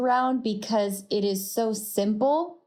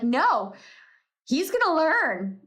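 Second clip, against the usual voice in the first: mock stubborn insistence; 7 semitones higher than usual. Mother insisting on punishment.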